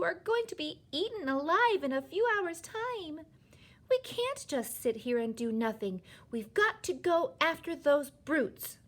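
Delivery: high, loud, desperate outburst; crying out in distress. A narrator's reading. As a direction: burst out in high-pitched indignation. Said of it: "stood up and cried out".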